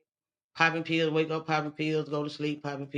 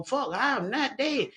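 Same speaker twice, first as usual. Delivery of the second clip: higher and slow, frightened; slow panicked denial; 7 semitones higher, slower than usual. Retold or imitated. She refuses to be dead, slow and higher. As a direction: drag out the denial in fear.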